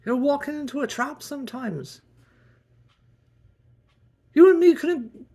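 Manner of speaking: high, loud scolding outburst; shrill angry Kendall. Acted. Kendall scolding him over the breakfast supplies, shrill and angry. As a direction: yell at him for using up supplies.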